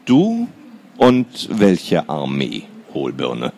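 dry, slow, unimpressed taunt; a sister unimpressed by her brother's threat. Acted. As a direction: shrug off his threat coolly. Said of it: "fragte Lena unbeeindruckt".

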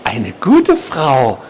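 drawn-out words, only lightly murmured; murmuring patient. A narrator's reading. Patient repeating himself to the nurse, slow odd murmur. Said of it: "murmelte er erneut".

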